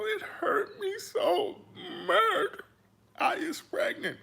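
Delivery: very high, hammed-up weepy wail; mock crying woman. Mocking the tearful video, high fake sob. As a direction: overplay the tears to ridicule her. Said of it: "all that crying".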